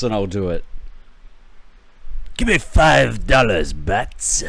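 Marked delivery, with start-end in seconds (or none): 2.4-4.4 Joker voice. Acted. Streamer does a bad Joker voice for chat donations. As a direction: tease like the Joker on a dare. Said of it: "I'll do a terrible impersonation of Joker".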